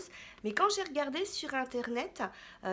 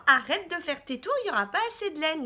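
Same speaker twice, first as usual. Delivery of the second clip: scolding; 3 semitones higher, 7 dB louder, faster than usual. Retold or imitated. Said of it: "on t'a dit à un moment".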